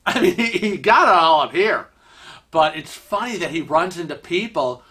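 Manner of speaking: ordinary talk, nothing marked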